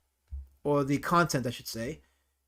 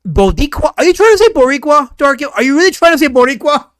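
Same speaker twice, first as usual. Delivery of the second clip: loud, high incredulous questioning; astonished, raised-voice grilling; 8 semitones higher, 11 dB louder than usual. Real, not acted. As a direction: shout the question in disbelief. Incredulous questioning of Dark Gift.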